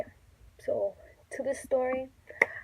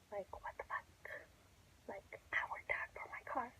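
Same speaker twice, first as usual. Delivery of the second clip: breathy whisper, almost under her breath; whispered venting; 16 dB quieter than usual. Real, not acted. Telling viewers about the stolen car, in a bitter whisper. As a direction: hiss the frustration quietly to yourself.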